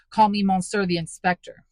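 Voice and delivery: loud, cutting rebuke; harsh correction. A narrator's reading. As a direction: snap the title at him. Javert interrupts harshly.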